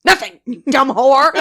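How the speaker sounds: loud, high comic insult; mock-angry yell. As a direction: blow up at the robot for laughs. Mock fight with Alexa.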